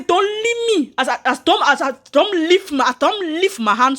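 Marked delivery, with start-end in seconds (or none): 0.0-0.9 1 desperate plea. Retold or imitated. Begging rescuer to let go.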